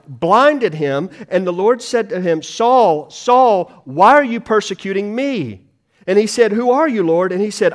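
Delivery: loud, ringing pulpit delivery; fervent preacher voice building through the conversion story; preaching at full force. Acted. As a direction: drive the story home to the congregation.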